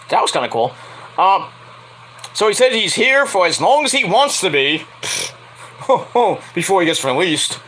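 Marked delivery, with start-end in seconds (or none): none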